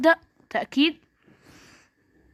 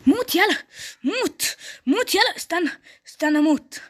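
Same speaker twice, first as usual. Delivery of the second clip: excited yelling; 6 semitones higher than usual. Real, not acted. Gamer yelling at a fleeing opponent in the fight. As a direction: egg the enemy on, voice up high.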